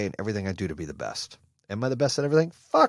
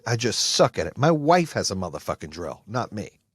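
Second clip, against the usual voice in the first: usual speaking voice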